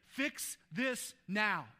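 slow, emphatic demand; demanding God. Retold or imitated. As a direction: spell out each word as if insisting. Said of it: "We want Jesus to step in and say".

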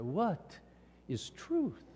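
soft, musing question with a rising end; Greek philosopher pondering. Acted. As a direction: wonder it quietly, pausing after WHAT. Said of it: "like the Greek philosopher".

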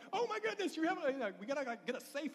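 high, loud retold astonishment; loud, high mock-astonished outburst; amazed relief. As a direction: exclaim it high, hamming up the amazement. Husband's retold amazement at found diamond.

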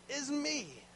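usual speaking voice

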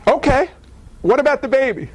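high, worried question; anxious Gabriel. Acted. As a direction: press God about the baby, on edge.

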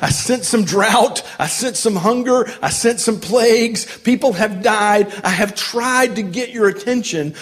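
exasperated pleading. Acted. Acting God pleading for attention.

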